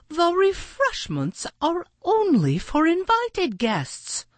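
very high, officious heron voice; Maggie calling out. A narrator's reading. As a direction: scold an intruder in a high bird voice. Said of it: "Maggie called out".

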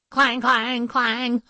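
high, ringing clangs; sing-out alarm clangs; clanging alarm bell. A narrator's reading. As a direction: imitate bells with ringing word endings. Imitating the monster alarm bells.

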